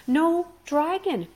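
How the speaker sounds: high, loud, slow stubborn insistence. A narrator's reading. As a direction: dig in and refuse, slowly. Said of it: "repeated Fish Legs stubbornly".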